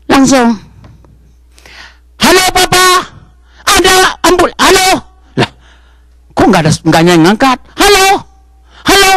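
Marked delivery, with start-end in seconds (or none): 2.2-9.1 put-on high small child calling down the phone. Child phones his dead father, high and calling. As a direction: call out like a kid whose father won't answer. Child phoning papa in story.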